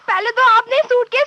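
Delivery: ordinary talk, nothing marked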